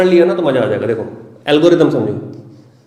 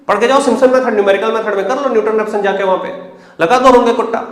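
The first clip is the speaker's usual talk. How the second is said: higher, louder, rushing exhortation; excited loud push; 5 semitones higher, 4 dB louder, faster than usual. Real, not acted. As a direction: fire them up to ask questions. Teacher fires up students.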